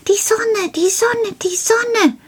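very high, excited little-man cry; Karl, very excited. A narrator's reading. Little man interrupts the reading about bright sides, high excited outburst. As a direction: pipe it out with repeated eager bursts. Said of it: "rief Karl ganz aufgeregt".

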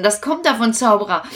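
ordinary talk

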